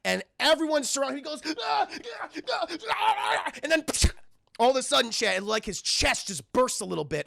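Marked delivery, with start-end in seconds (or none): 1.6-3.5 very high, strained cries; mock screams of pain. Kane surrounded as his chest bursts, imitated pained screaming. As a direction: imitate agonized shrieks without extra volume.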